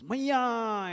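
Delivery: high, drawn-out cry of "Maiya"; child's eager call to mother. Acted. Child runs calling to mother.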